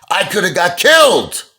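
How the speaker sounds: loud, high-pitched outraged yell; flipped out. Retold or imitated. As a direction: explode like a furious Sicilian father. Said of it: "He flipped out".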